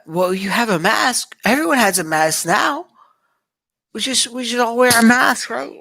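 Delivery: high, loud sarcastic innocence; mock innocence. Vlogger mocking protesters' masks, high sarcastic. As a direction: play dumb and sarcastic.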